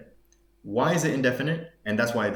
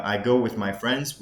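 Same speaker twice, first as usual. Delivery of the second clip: usual speaking voice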